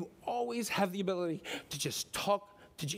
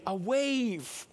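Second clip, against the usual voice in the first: louder, higher sermon drive; preaching at full force; 6 semitones higher, 4 dB louder than usual. Acted. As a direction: press the point home to the congregation.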